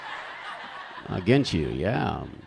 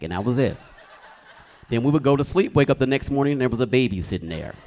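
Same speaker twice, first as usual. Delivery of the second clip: normal talking voice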